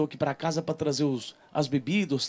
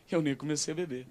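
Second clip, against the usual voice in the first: whispered laugh; 7 dB quieter than usual. Real, not acted. Laughing aside after stumble.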